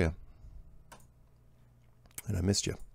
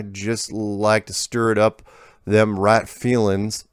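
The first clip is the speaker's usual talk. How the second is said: unhurried backwoods American twang; folksy rural accent; slower than usual. Retold or imitated. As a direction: read the letter like an old country farmer. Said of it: "He's not breaking character".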